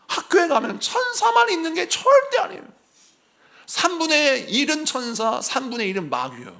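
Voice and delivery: sudden high shout from the pulpit; emphatic shout. Real, not acted. Preacher warning students, shouting. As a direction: cry it out as a warning.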